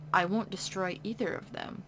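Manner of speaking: usual speaking voice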